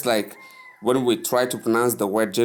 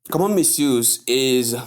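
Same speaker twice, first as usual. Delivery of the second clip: usual speaking voice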